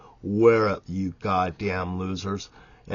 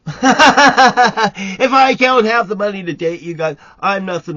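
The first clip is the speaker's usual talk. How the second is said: laughing; 12 semitones higher, 14 dB louder than usual. Real, not acted. Laughing rant to women viewers.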